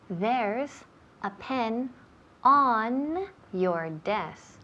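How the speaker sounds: very slow, stretching ON; teacher model. Shown as a demonstration. Teacher modelling a contraction for the student, very slow delivery. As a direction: say it slowly in chunks, every word clear. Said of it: "Dame la contracción".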